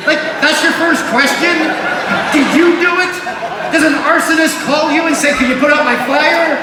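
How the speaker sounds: high, loud outraged protest; indignant shouting. Real, not acted. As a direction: yell it in disbelief. Outraged at operator's question.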